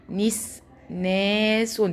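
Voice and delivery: نسناس drawn out very slowly, syllable by syllable; slow syllable demo. Shown as a demonstration. Teacher sounding out نسناس slowly for first graders. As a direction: stretch نسناس for the class. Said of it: "ناخد بالنا يا سنة أولى".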